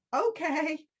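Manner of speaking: a hint of a terrified gladiator; scared okay. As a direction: squeak it out nervously. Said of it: "he was scared to death".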